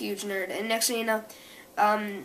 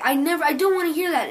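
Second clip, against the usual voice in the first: higher, quick mock outburst; retold anger; 6 semitones higher, 5 dB louder, faster than usual. Boy getting mad at his mom's remark, mock angry. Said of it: "'cause he's getting mad".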